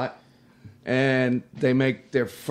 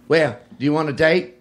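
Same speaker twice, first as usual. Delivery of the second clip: hitchhiker's offer; 4 semitones higher, 8 dB louder than usual. Retold or imitated. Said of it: "she goes".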